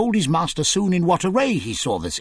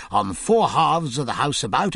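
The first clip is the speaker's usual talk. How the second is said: usual speaking voice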